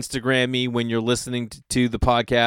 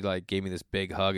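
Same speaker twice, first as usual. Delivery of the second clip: normal talking voice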